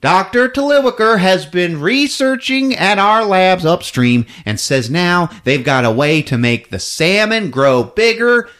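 a hint of a smarmy CEO; salesman executive. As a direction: sell the cannery like a huckster. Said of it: "He's like".

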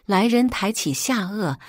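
usual speaking voice

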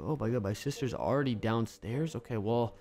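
low, soft groggy mumble; just woken up. Pretend. Player waking to find sister downstairs, groggy. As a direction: talk as if barely awake. Wakes up in bed.

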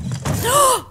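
high sharp gasp of fright; startled gasp. Real, not acted. Player hears a strange noise, high frightened gasp. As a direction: suck in a high gasp, scared.

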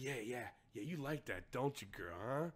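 low, quiet phone-sex muttering; soft, low mock come-on; mock sleazy lover. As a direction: imitate a man talking dirty, hushed and low. Imitating man on the sex call.

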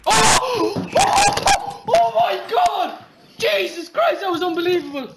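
ecstatic shrieking high yell; man down shout. Real, not acted. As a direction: scream in disbelief at the play. Huge play just happened.

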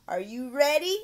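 put-on rally a young pupil; eager teacher prompt. Shown as a demonstration.

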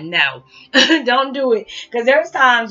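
choked-up laugh; laughing through tears. Real, not acted. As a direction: laugh so you won't cry. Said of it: "I'm not gonna get emotional".

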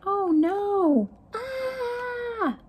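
high, anguished little cry of dismay; a robot's heart breaking, cry out; heartbroken robot. Acted. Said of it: "A very upset and heartbroken robot".

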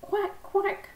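high quacking, only mildly shouted. A narrator's reading. Daisy quacks at the duck pond, mock shouted quack. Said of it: "shouted Daisy at the top of her voice".

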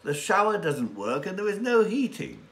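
high, exasperated wife; Thea's voice. Acted. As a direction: voice Thea as a fed-up young woman. Thea complains about hotel.

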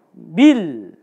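밀 said short and clipped off, as an entering tone. Shown as a demonstration. Lecturer showing students the entering tone, 밀 said short and clipped. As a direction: cut 밀 off sharply at the final l. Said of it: "입성입니다".

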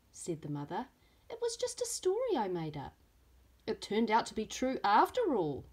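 lively storybook reading with voiced characters; reading to children. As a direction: read it like story time for little ones. Teacher reads picture book aloud.

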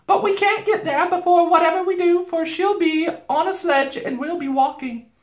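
high, loud housewife; Missus Beaver. A narrator's reading. Beaver wife explaining their odds, high and practical. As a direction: explain matter-of-factly in a high voice.

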